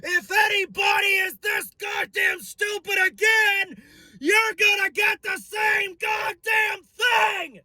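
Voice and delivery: high, slow, straining threat; mock warning shout. Team warning the Giants' fans, screamed high and slow. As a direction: roar the warning to the crowd. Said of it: "And we said".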